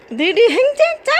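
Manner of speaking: talking to a dog. Real, not acted. Teasing the dog happily.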